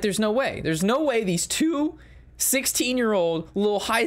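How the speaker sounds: rising high, squeaky complaint; whiny protest. Retold or imitated. Mocks the opposing player's high whiny protest. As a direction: play the sore loser whining. Angry opponent's protest retold.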